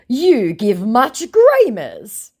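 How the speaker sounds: high, slow scammer voice; mock scammer voice. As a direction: drag it out like a clueless scammer. Voicing the scammer.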